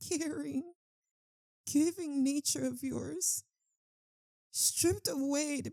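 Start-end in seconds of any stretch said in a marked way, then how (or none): none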